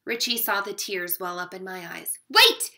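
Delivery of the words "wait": high, loud call to stop everyone. A narrator's reading. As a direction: shout it to halt the room. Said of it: "he called out".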